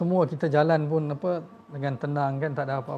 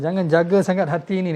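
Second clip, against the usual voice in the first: loud, raised preaching line; emphatic warning; 4 semitones higher, 8 dB louder than usual. Acted. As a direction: call it out firmly to the congregation. (seruan lantang)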